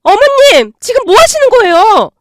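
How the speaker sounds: high, loud indignant cry; shocked outcry. Acted. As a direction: scream in outrage at her mother-in-law. Sister-in-law shocked at attack. (높고 크게 놀라 외침)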